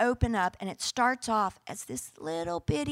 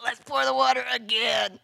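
mocking. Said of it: "just really mocking them".

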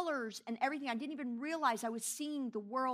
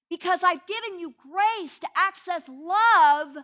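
loud, passionate preaching as God, higher and louder; God's voice; 6 semitones higher, 10 dB louder than usual. Retold or imitated. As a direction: thunder God's urgent plea to come back to love. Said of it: "And he's like".

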